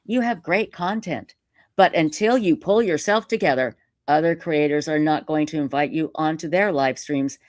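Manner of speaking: usual speaking voice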